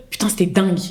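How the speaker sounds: casual French accent for a French friend. Shown as a demonstration. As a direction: switch into a French-from-France accent. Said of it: "mon ami à côté avec un accent français".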